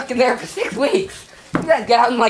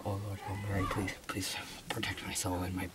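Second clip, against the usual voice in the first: very low, barely audible mock prayer; hushed praying; 11 semitones lower, 20 dB quieter, faster than usual. Kid hiding from a seeker, hushed pretend prayer. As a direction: mumble a desperate prayer under your breath.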